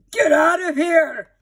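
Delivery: loud, slow angry shout; father at the door shouting at barking dogs. A narrator's reading. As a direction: yell to chase the dogs off. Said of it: "Father came to the door and shouted".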